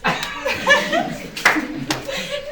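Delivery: high, loud burst of laughter; laughing. Real, not acted. Comedian laughing at someone in the room, high loud laugh. Laughs at audience member's gesture.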